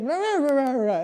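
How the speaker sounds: put-on slurred, loud wordless drunk bellowing, pitched high; drunken yelling. Drunk man at gas station yelling at him, loud and slurred. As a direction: bark nonsense like a plastered drunk.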